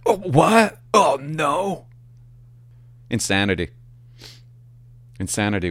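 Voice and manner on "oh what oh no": high, fake-shocked gasping no; mock flustered denial. Dispatcher caught out about her crush, high and fake-shocked. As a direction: act caught out and deny it.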